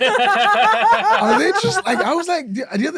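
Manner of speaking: high, laughing incredulous squeal; laughing disbelief. Retold or imitated.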